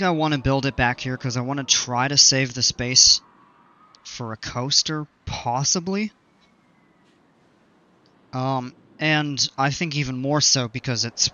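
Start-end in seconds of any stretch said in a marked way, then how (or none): none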